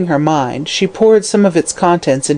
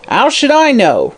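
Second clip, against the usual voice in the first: sullen retort; 8 semitones higher than usual. Acted. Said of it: "retorted Mother Guttersnipe sullenly".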